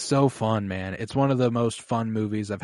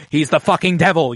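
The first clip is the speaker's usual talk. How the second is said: outraged conservative; 5 semitones higher, 7 dB louder than usual. Retold or imitated. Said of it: "conservatives were like".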